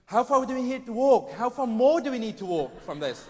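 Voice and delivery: loud, high whiny moan; moaning festivalgoer. Retold or imitated. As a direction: whine like a spoiled camper. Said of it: "I was going".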